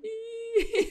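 bright giggling laugh; high burst of laughter. Real, not acted. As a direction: break into laughter. Laughing at daughter's question. (risa aguda y espontánea)

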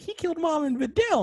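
high-pitched mock wail of a child; Goten. Acting out upset child Goten.

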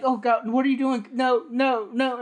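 play-acted cry of distress for a prank; mock panic. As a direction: pretend something is grabbing you. Acting out a prank's fake panic.

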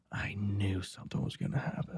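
quiet, knowing remark to oneself; hushed aside. Retold or imitated. Recalling a hunch about passersby, hushed aside. Relaying own thought, low and slow.

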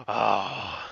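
grudging villain. Acted. Villain concedes.

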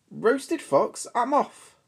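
high, hurried fox in a fright; a fox who wants to flee now; alarmed fox. Acted. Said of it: "Fox said".

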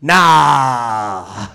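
low, drawn-out mock refusal; dismissive mom. Mom reacting to his comedy career, dismissively low. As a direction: imitate mom shrugging it off, long and low. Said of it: "and she was just like".

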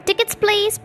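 high-pitched ticket call; mild shout. A narrator's reading. Collector making his rounds of the carriages, mild shout. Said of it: "he keeps shouting".

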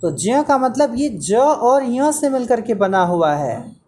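ordinary talk

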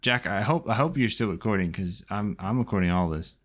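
usual speaking voice

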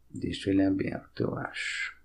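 unvoiced murmur to herself; whispered aside. Real, not acted. Muttering to herself at the computer. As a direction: trail off under the breath.

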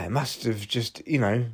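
ordinary talk

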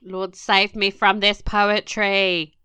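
slow, dramatic mock plea to God; mock prayer. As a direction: beg the heavens, hammed up.

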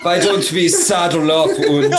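German-accented cowboy impression; mock western hero gone German; German John Wayne. Rallying riders, comic German cowboy voice. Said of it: "German John Wayne Pilgrim".

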